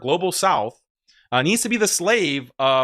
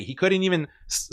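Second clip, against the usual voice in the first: ordinary talk, nothing marked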